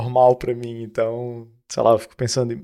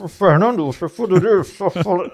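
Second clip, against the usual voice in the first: mock garbled phone voice, pitched higher; imitação de telefone; 7 semitones higher than usual. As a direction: imitate an unintelligible caller on a bad line. Imita voz abafada no telefone. (imitação de voz embolada no telefone)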